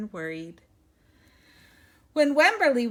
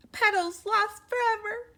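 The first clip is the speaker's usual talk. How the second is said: panicked wail; 12 semitones higher, 3 dB louder than usual. Acted.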